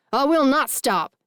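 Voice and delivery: loud, high furious roar; enraged father refusing to back down; thundering. A narrator's reading. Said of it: "he thunders back in that awful voice".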